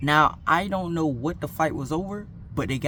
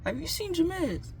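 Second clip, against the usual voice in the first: put-on high girl's voice; 14 semitones higher, 6 dB quieter than usual. Girl asks narrator.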